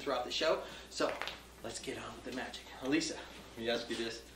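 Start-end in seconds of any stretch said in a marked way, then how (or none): none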